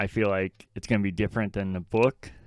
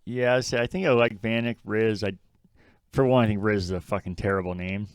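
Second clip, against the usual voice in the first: normal talking voice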